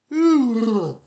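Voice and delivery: loud nostalgic sigh; heavy sigh. Real, not acted. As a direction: let out a long heavy sigh. Nostalgic pause.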